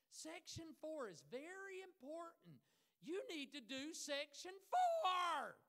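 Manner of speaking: slow, very loud mock yelling; exaggerated teacher shout. Imitating a teacher ordering students, exaggerated shouting. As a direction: hammer it out slowly, building to a hammed-up bellow. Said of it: "She didn't talk like that".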